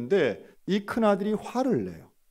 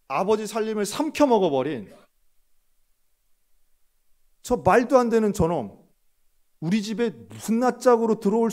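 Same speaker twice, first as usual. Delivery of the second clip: louder, higher indignant tirade against the brother; angry grievance; 5 semitones higher, 5 dB louder than usual. Retold or imitated. Elder son raging about his brother to the father. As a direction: spit out his contempt for the returned brother.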